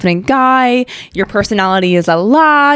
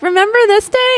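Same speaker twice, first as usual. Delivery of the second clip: a sing-song, much higher app reminder; mock app prompt; 13 semitones higher than usual. Imitating a Snapchat memory prompt, high and chirpy. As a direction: chirp it like a cheerful notification. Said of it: "Snapchat used to be like".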